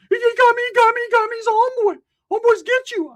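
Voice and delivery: high cartoonish whine of excuses; squeaky Ricky. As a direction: squeal it like a beat-up kid. Ricky voiced.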